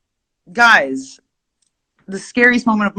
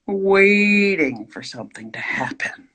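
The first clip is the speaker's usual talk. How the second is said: dramatic whisper. Acted. Dramatic whisper about instructor.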